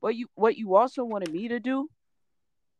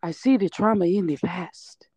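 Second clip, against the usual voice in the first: breathy whispered fortune-teller voice; mock psychic. To her co-host, mocking a psychic reading in a whisper. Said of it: "Not Miss Cleo".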